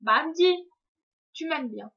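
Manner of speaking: Mamdi called out high and loud in a French Algerian accent. Shown as a demonstration. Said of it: "T'as vu, j'ai fait avec l'accent". (Mamdi lancé fort et haut avec l'accent français algérien)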